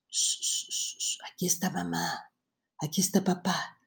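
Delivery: put-on hushed, soft shushing to a child; whisper-soft comfort, like calming a scared kid; soothing a child.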